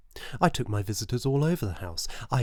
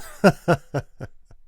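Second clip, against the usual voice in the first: a short, loud, crazed laugh; mad chuckle; 5 semitones higher, 9 dB louder than usual. A narrator's reading. Killer laughing at his own cleverness, crazed chuckle. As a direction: laugh like a madman proud of his work.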